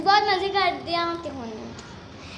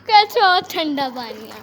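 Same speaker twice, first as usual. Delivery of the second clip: in ice cold water; 5 dB louder, slower than usual. Real, not acted. Getting into cold tub water.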